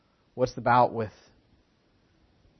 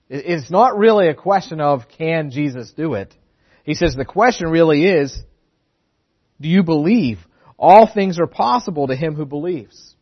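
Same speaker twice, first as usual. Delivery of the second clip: louder, higher preaching push; preaching with force; 4 semitones higher, 9 dB louder than usual. Acted. Pastor proclaiming Jesus' answer to the congregation, forceful preaching. Preacher drives home the point.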